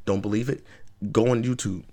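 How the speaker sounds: ordinary delivery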